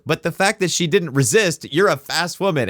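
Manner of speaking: high, laughing mock woman's retort. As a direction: squeal it out in an exaggerated high voice, laughing. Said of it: "It's like".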